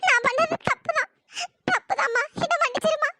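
tearful, broken apology; weeping, words split by sobs; crying. Acted. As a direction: plead for forgiveness through sobs. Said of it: "அழுகாதீங்க".

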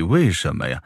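ordinary delivery, nothing marked

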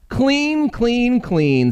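high, eager little housefly voice. Acted. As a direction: chirp it out like a busy housefly. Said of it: "said the housefly who was sweeping".

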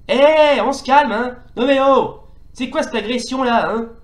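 loud, high "calm down" at the enemies; indignant telling off. Real, not acted. Gamer scolding on-screen enemies, indignant shout. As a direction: scold the attackers sharply.

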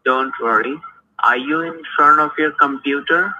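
usual speaking voice

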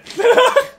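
squealing laugh; high giggle. Real, not acted. Laughs after retelling.

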